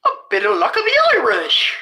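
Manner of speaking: high, lilting mock Irish voice; hammed-up Irish accent, swooping high; put-on Irish accent. Shown as a demonstration. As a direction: exaggerate a playful Irish accent. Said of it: "Irish accent".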